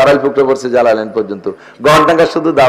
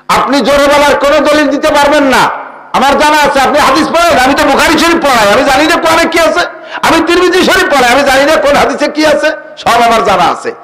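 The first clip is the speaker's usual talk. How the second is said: mock boast; 9 semitones higher than usual. Boastful opponent mocked in argument.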